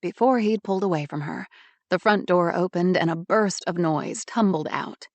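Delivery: ordinary delivery, nothing marked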